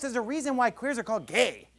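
ordinary talk

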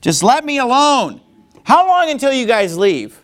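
high, exasperated outburst; fed up and raising the voice; angry demand. Acted. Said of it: "can you feel the anger".

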